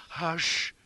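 high, hushing interruption; urgent hush. A narrator's reading. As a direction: shush a panicking friend. Companion silences frantic friend.

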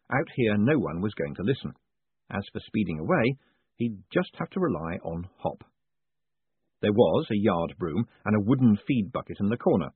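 ordinary delivery, nothing marked

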